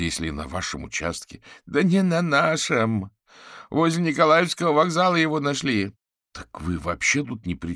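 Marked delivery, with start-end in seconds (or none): 1.7-5.9 high, loud agitated voice; panicked protest. Acted. Fetisov corrects Averin over the phone, frantic.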